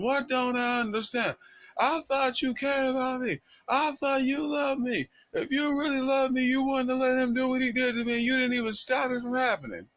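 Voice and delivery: high-pitched, hammed-up screaming accusation; retold screaming wife. Wife screaming at husband who failed her, high mock scream. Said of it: "She's screaming and crying and yelling at him".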